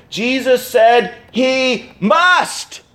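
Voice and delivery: slow build to a stressed "must". Shown as a demonstration. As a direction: drag the phrase, landing hard on "must". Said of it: "You know what it is?".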